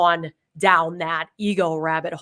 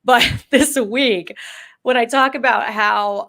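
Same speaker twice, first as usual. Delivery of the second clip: bursting out with a laugh, louder; 4 semitones higher, 8 dB louder, slower than usual. Real, not acted. Host laughs as she starts her dog story, laughing. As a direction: laugh through the words.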